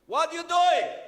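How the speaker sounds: high, very loud outburst; exasperated yell. Retold or imitated. Director catches the host's mistake, exasperated yell. Director yells at host again.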